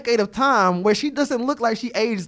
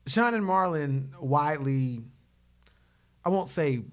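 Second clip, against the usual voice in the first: normal talking voice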